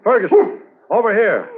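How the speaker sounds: high, loud call across a field. Acted. Handler calling his partner over from a distance, loud high shout. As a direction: shout to him from far off.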